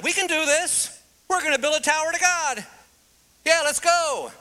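high, fast, eager boasting; cocky builders. Retold or imitated. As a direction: pump up the crowd like cocky builders. Mankind boasting at Babel.

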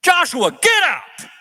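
high, loud, slow call of Joshua, get up; God's command. Acted. God answering Joshua's grieving, commanding. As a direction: call him to his feet with authority. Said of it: "you know what God says?".

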